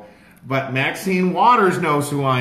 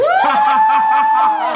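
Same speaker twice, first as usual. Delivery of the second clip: loud laugh; 10 dB louder than usual. Real, not acted. Recounting Maxine Waters' call, bursting into loud laughter.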